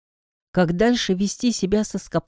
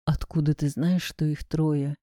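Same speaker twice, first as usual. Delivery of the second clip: soft, low hushed question; Skoptsov, quietly; 6 semitones lower, 6 dB quieter than usual. A narrator's reading. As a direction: ask under your breath, pitched low. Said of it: "Так же тихо спросил Скопцов".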